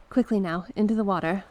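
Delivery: excited urgent command; urgent hurry. Acted. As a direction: rush her along to the water. Hurrying into the water.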